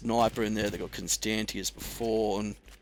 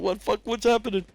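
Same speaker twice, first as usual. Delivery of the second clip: mock panicked yell; 11 semitones higher, 4 dB louder than usual. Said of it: "He's going".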